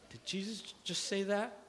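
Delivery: high, stunned disbelief with a stumble; mock shocked. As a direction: play the scandalized onlooker. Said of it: "there's a gasp in the room".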